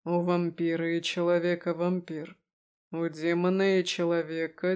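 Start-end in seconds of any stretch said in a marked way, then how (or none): none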